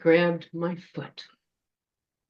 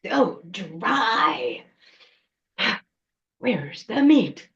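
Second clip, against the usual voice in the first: put-on witch voice, slowing in disgust; peevish creature grumbling over its food; complaining troll witch; much slower than usual. Troll witch chewing a dry branch, disgusted grumbling.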